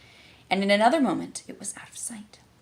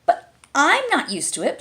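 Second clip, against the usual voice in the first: higher, pleading and pitiful; piteous; 8 semitones higher, 6 dB louder than usual. A narrator's reading. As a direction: plead like a hurt child. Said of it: "in a piteous tone".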